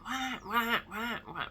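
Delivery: high mock chick wailing; baby bird cries. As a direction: imitate hungry chicks crying wah. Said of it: "they just like".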